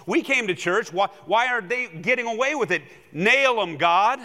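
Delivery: hammed-up outrage demanding God punish them; mock indignant demand. Pastor mimics churchgoers demanding God nail sinners, a loud mock outrage.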